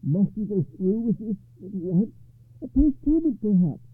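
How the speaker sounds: anxious plea, high and loud; high-pitched worried appeal; nervous. A narrator's reading. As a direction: urge it nervously, pitched well up. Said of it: "took his nephew aside nervously".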